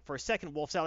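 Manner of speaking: ordinary talk, nothing marked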